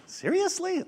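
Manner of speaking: can't believe what he's hearing; incredulous. Acted. Buyer stunned the merchant wants his pocket money, incredulous.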